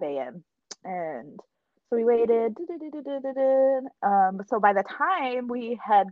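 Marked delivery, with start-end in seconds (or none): 2.6-3.9 rapid high "du-du-du" babble; sing-song filler noise. Retold or imitated. Fan skipping over the long wait, sing-song filler noise. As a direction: skip through time with quick repeated syllables.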